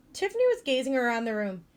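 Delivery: usual speaking voice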